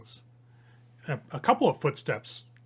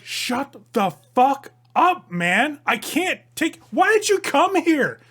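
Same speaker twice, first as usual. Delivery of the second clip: much higher, much louder furious yell; angry shouting; 12 semitones higher, 9 dB louder, slower than usual. Acted. Damien yelling at Newberry: furious shout. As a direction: snap back at him at full volume. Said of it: "And Damien's like".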